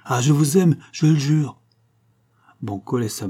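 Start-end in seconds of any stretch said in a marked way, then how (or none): 0.1-1.6 higher, louder vow of love; impassioned lover's plea; ardent declaration. A narrator's reading. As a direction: swear it passionately, pitch lifted. Ardent lover swears his love.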